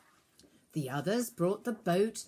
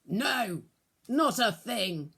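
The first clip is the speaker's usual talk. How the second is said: a mildly cross, louder answer; angry shout; 3 semitones higher, 5 dB louder, slower than usual. A narrator's reading. Peter answers the stranger after a failed night, mildly cross. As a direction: snap back a little, tired and fed up. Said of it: "Peter shouted angrily".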